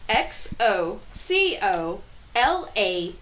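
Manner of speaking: slow letter-by-letter spelling. Real, not acted. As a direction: spell out each letter slowly. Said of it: "and I quote".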